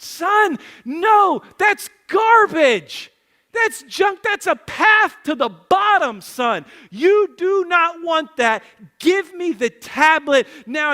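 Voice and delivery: acted high shout, slow and emphatic; impassioned dad hammering each phrase slowly; retold pleading. Father pleads with son to give up the tablet, shouting. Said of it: "I literally started pleading with him".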